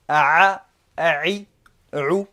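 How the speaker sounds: each vowel pushed from the middle of the throat; Arabic throat consonant with vowels; throat letter demo. Shown as a demonstration. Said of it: "se prononce au niveau de, au niveau de, du milieu de la gorge".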